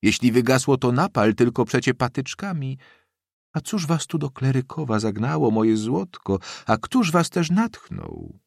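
put-on high-pitched, lively old lady; excited old woman. A narrator's reading. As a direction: bustle with orders and delighted questions. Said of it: "krzyknęła głośniej w kierunku sionki".

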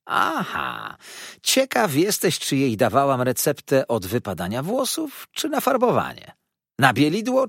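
high, lively, teasing gossip voice; chatty dwarf lady. Acted. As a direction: play the nosy little hairdresser, teasing. Karlica Moucher mówi. (wysoki, figlarny głos plotkarskiej karlicy)

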